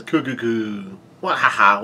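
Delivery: half-hearted imitation of a cartoon laugh; retold villain laugh. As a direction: spell out a mock laugh, syllable by syllable. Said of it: "Pearl Sparrow starts laughing".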